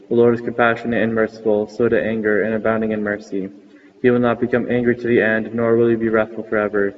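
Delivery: normal talking voice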